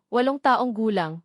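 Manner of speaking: ordinary delivery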